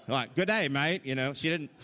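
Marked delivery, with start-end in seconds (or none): 0.4-1.1 high-pitched mock Aussie greeting; Aussie accent. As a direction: put on a light Australian accent. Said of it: "she wasn't Aussie".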